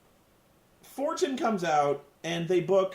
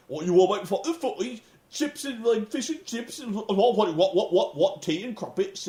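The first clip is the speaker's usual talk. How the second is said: quick, hammed-up British parody rising into gibberish; exaggerated British mimicry, babbling faster; mocking British accent; 3 semitones higher than usual. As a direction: tease with a cartoon British accent. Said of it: "they all start making fun of him".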